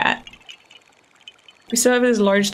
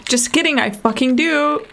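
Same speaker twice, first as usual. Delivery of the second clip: usual speaking voice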